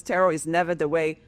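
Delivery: ordinary talk, nothing marked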